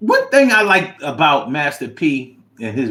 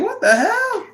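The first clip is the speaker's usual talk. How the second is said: high-pitched mock bewilderment; startled reaction. Onlookers reacting to the yelling cop, mock startled.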